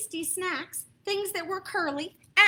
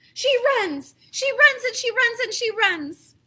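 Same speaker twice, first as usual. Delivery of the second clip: higher and louder, with a laugh; excited narration; 8 semitones higher, 11 dB louder than usual. A narrator's reading. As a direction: rush it like she's running. Running on and on.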